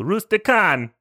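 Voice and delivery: high clipped Italian, last syllable dropped; fake Italian accent. Shown as a demonstration. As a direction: Rustican said without the final a. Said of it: "to do a proper fake Italian accent, you have to take off the last syllable".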